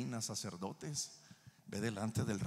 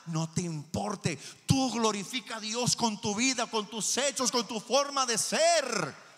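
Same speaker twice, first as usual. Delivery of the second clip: raised, high preacher's delivery; forceful preaching; 13 semitones higher, 8 dB louder than usual. Real, not acted. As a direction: exhort the congregation with driving emphasis.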